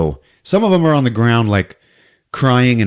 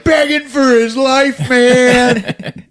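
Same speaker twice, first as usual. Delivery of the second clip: mock desperate begging; 12 semitones higher, much slower than usual. Storyteller acts out the guy begging for life, hammed-up high cry. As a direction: stretch it into an exaggerated wail.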